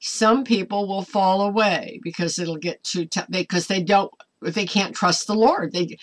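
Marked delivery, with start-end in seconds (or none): none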